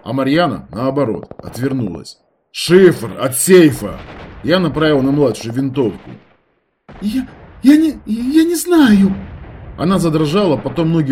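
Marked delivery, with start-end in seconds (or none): 2.5-4.0 demanding. A narrator's reading. Threatening with rifle. 7.0-9.1 put-on high, loud stammering girl; terrified stammer. A narrator's reading.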